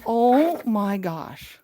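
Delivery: slow, loud gasp of awe; drawn-out wonder; amazed. Real, not acted. Overwhelmed on finding it.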